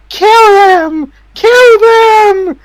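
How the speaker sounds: roaring the order high and loud; villain screaming at his giants to attack; furious shout. Acted.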